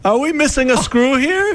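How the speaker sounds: squeaky, very high put-on voice; hapless furniture assembler. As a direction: play a bewildered assembler way up high. Plays innocent furniture assembler.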